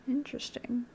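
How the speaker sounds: soft whispered interesting; hushed curious aside. Real, not acted. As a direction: whisper it thoughtfully. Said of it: "whispered aside".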